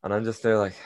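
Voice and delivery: ordinary talk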